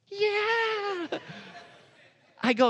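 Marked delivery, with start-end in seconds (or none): 0.1-1.2 high, easy answer; just shrug it off; officer's casual yes. Retold or imitated. Officer to the preacher, a high casual yes. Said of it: "He goes".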